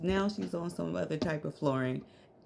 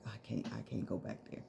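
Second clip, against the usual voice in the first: quiet refusal; 9 semitones lower, 9 dB quieter, faster than usual. Retold or imitated. Quoting daughter refusing.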